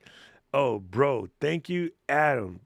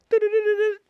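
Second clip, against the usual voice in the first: sudden high cackle of laughter; loud laugh burst; 12 dB louder than usual. Real, not acted. Laughing loudly at a joke on the stream.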